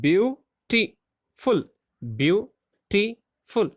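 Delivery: beautiful drawn out slowly, each syllable spaced; teacher slowly sounding out beautiful. Shown as a demonstration. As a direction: stretch beautiful slowly for the class. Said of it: "There are three syllables".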